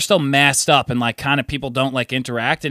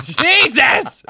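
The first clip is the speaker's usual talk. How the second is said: high startled yelp; shocked shout; 13 semitones higher, 3 dB louder than usual. Retold or imitated. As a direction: cry out as she hits the door. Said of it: "I was like".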